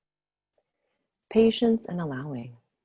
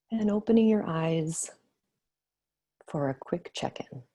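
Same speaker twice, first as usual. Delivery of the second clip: guided meditation. Acted. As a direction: ease them gently back to the room. Leads closing of meditation.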